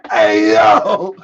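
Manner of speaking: low strained laughing yell; real laughing holler; laughing cry. Real, not acted. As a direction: howl with laughter. Laughing outburst.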